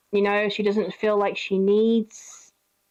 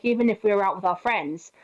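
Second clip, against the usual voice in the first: ordinary talk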